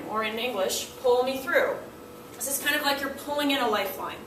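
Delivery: ordinary talk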